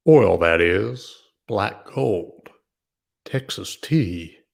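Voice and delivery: put-on slow, lightly drawled country accent; a hint of a hillbilly country accent; Beverly Hillbillies country accent, lightly done. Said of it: "my country accent".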